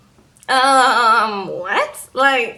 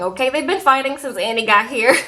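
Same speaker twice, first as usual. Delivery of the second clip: normal talking voice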